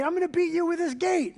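comic high voice of a goofy attacker; mock threat.

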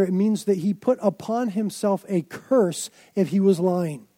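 normal talking voice

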